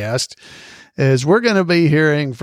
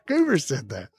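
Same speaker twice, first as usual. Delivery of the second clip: ordinary talk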